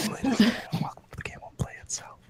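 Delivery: whispered announcer intro; soft ASMR host voice. Pretend. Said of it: "filling up the A S M R sort of section".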